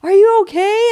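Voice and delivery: high, loud worried question; a high, loud, worried check on the husband; concerned call. Retold or imitated. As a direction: rush to check on him. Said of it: "I said".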